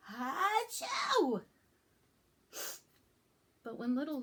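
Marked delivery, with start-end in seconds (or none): 0.1-1.4 loud, high hammed-up achoo; a big pretend sneeze; fake sneeze. Reading the book as if sick, loud fake sneeze.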